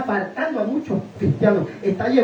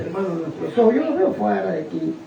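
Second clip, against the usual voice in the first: ordinary talk, nothing marked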